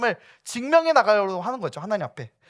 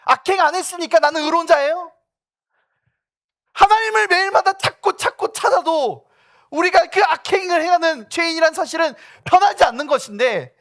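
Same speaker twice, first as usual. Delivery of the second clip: loud, higher fiery sermon; preaching at full force; 11 semitones higher, 9 dB louder than usual. Acted. Pastor challenging the congregation about pride, loud fiery preaching. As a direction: press the congregation hard.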